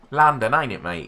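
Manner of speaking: put-on loud, high Essex geezer accent; broad Essex accent, loud and high. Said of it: "More, more Essex".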